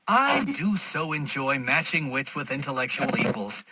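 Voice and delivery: ordinary delivery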